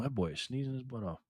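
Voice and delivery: usual speaking voice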